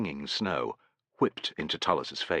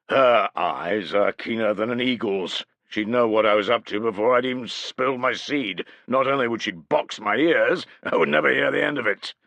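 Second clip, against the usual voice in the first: put-on hearty older warrior, louder and higher; bluff chieftain voice; 6 semitones higher, 7 dB louder than usual. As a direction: boom it out like a lusty chieftain. Malavendus joking about his wife.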